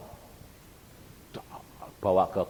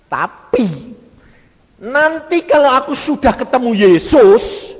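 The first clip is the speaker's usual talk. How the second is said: high, ringing proclamation; loud declaration; 12 semitones higher, 20 dB louder than usual. Retold or imitated. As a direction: declare it forcefully at full volume. Retold defiant reply to the tempter. (pernyataan lantang bernada tinggi)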